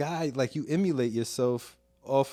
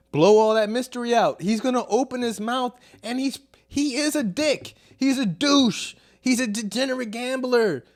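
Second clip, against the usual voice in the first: worked-up rant; 7 semitones higher than usual. Real, not acted. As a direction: vent with heat. Ranting at Jordan.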